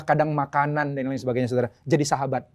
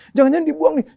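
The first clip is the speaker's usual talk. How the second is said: high-pitched alarmed worry; anxious thought acted out; retold panic; 11 semitones higher, 4 dB louder, faster than usual. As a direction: voice the sudden fear, pitched way up. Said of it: "udah panik".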